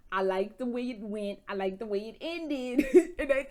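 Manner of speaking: ordinary delivery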